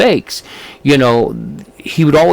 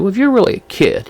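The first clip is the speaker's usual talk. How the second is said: higher-pitched retold dare; a light, raised-pitch challenge; mildly challenging; 9 semitones higher than usual. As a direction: imitate someone casually testing a child. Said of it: "he was kind of challenging".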